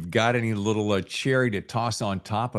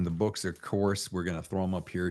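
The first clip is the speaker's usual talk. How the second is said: ordinary talk, nothing marked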